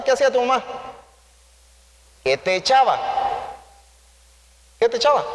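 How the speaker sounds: ordinary delivery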